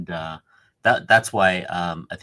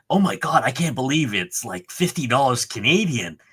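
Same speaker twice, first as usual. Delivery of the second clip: high, louder mock astonishment; amazed disbelief; 6 semitones higher, 4 dB louder than usual. Retelling a buyer's amazement at Canadian prices, mock astonished. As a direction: exclaim it like an imitated astonished buyer.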